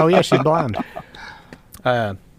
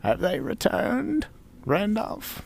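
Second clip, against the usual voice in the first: put-on high, slow old lady voice; old woman voice; 13 semitones higher, slower than usual.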